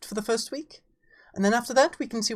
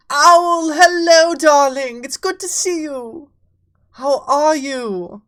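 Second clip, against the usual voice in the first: excited greeting; 6 semitones higher, 10 dB louder, slower than usual. Real, not acted. Streamer welcoming Owl to the stream, loud and high. Greeting a newly arrived friend.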